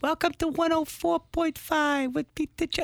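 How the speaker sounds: put-on high, slow little-girl voice, lightly done; a hint of a small child; imitating his little daughter. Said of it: "it's adorable".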